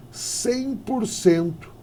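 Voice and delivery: "Cem por cento" stretched slowly; slow emphasis. Shown as a demonstration. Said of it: "emphasising key figure". ("Cem por cento" bem devagar e enfático)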